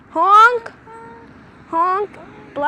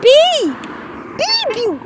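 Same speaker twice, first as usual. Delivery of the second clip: shouted beep; 7 semitones higher, 3 dB louder, much slower than usual. Real, not acted. Yelling at a late car.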